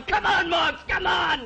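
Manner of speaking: high-pitched race-track cheer; excited cheering yell. Acted. Jockey hopeful acting out his friends cheering him home, yelling. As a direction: yell them on down the home stretch. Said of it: "You're gonna be yelling".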